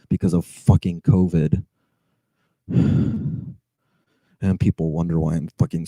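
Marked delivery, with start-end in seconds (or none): 2.7-3.5 breathy, defeated exhale; real weary sigh. Real, not acted. Driver facing two ruined jobs, real weary sigh. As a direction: breathe out wearily.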